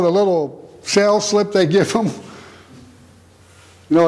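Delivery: ordinary delivery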